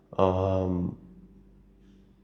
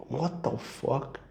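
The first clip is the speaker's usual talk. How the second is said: stunned disbelief; 6 semitones higher, 8 dB quieter, slower than usual. Real, not acted. Reacting in disbelief to the story.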